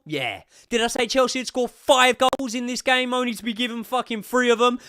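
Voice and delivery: high, loud taunting rant; excited gloating. Real, not acted. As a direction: crow about being right. Gloating after the goal.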